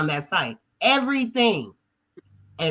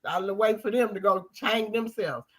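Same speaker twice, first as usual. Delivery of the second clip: normal talking voice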